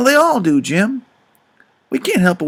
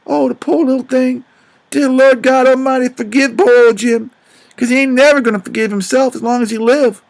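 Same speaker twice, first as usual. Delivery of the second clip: crying out; 5 semitones higher than usual. Retold or imitated. Jim holding his deaf child, praying in tearful grief. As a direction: cry it out to the Lord. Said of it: "I bust out a crying".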